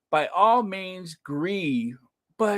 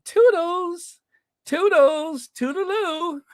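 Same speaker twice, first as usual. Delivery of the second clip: toodles; 11 semitones higher, slower than usual. Retold or imitated. Mocking his waving goodbye.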